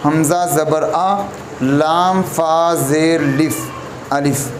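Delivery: slow, deliberate spelling of each sound; careful Qur'an letter spelling; slow spelled-out letters. Shown as a demonstration. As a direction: spell it out slowly for the student. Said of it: "अलिफ का जो असल तरीका पढ़ने का है वो ये है".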